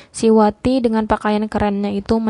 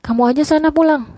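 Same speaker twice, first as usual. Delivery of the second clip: higher, louder huffy comeback; indignant dismissal, pitched up; grumpy retort; 6 semitones higher, 5 dB louder than usual. A narrator's reading. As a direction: send them off with a raised, irritated voice. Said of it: "sungutnya yang membuatku terkejut".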